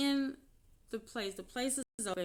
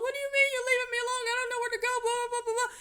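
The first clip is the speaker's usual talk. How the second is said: much higher, fast whiny mock voice; mocking ex-husband; 8 dB louder, much faster than usual. Mimicking her ex-husband's pleading, high whiny mockery. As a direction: squeak it out like a whining pest.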